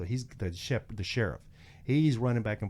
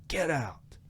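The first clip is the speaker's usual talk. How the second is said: sharp, higher-pitched cop's command; retold barked order; 6 semitones higher than usual. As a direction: act out an officer ordering someone out. Deputy orders Travis out of car.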